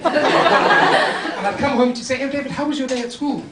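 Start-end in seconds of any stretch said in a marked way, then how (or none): 2.2-3.4 put-on higher-pitched English-accented mum; English mother. Mother asking son about school, higher English accent. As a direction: greet the child in a raised English voice. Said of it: "See, she was English".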